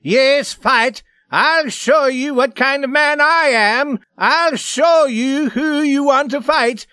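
high, loud, frenzied tirade; mad roaring rage. A narrator's reading. Swede raging at the man he accuses, frenzied roaring. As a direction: rant like a mad devil at full pitch. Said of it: "He was like a mad devil".

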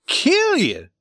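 very high, loud, falling exclamation; incredulous shout. Acted. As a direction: throw it back in disbelief. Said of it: "shouted Scully".

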